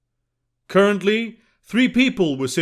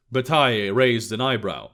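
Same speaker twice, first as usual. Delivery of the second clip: ordinary delivery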